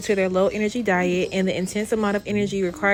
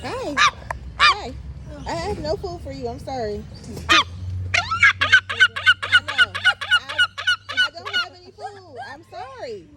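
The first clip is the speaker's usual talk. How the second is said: much higher pitched, sweet apologetic coo; high baby talk to bird. Acted. To a bird begging for food, high cooing apology.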